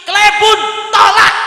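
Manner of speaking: loud, emphatic sermon delivery; fiery preaching. Acted. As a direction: preach it forcefully with lifted voice.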